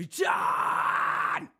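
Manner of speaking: loud unvoiced twangy scream; twang scream. Shown as a demonstration. Coach showing the student twang in a scream. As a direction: blast John with twang. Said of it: "that was just a twang".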